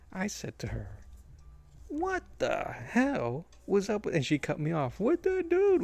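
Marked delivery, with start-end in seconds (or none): 1.9-4.1 high, drawn-out disbelief; baffled complaint starting to his daughter; retold incredulous question. Retells his outburst to daughter. 5.0-5.8 very high, loud squawk of disbelief; retold shocked interjection. Daughter cuts him off, equally baffled.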